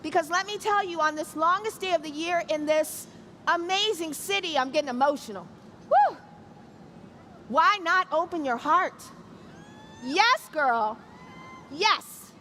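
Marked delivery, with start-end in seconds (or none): 5.9-6.2 high, loud excited woo; whoop. Real, not acted. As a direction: let out a happy cheer. Getting emotional, cheers.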